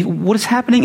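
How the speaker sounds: high, bewildered mock panic; mock freaked-out. Disciples as the town comes out, mock freaked-out. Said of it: "they're, like, freaking out".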